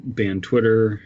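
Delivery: usual speaking voice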